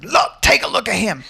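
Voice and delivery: high-pitched urgent order, imitating a coach; mock scolding without full volume; retold mock yell. Coach dad snapping his fighter's head round, mock yell. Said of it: "he's just screaming at Rob".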